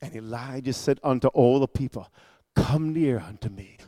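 very soft, low scripture delivery; hushed reading. Acted. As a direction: draw listeners in with a low hush. Hushed first reading before the shouted praise.

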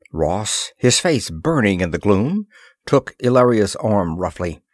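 plain reading voice

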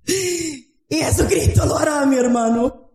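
high, strained exhortation; impassioned preaching. Real, not acted. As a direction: preach with full fervour, drawing out the key words. Preaches at full force.

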